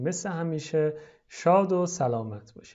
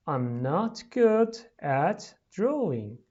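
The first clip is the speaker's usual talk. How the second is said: slow, carefully separated words; slower, word by word; much slower than usual. Shown as a demonstration. Teacher reading for pupils to repeat, slowly. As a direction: say each word slowly and distinctly.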